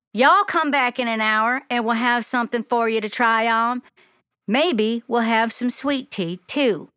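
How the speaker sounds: put-on Southern lady telling a kid to hold still; Southern accent. A narrator's reading. Sophia measures Glory and tells her to stand still, Southern accent. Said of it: "In a thick Southern accent".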